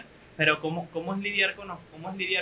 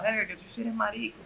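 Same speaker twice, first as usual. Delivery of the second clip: higher-pitched mocking imitation of a jeer; imitated macho taunt; 7 semitones higher than usual. Imitating a sexist comment to a buddy, mock taunting. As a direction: mimic a macho guy needling his pal. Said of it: "ese comentario machista, decirle a otro pana".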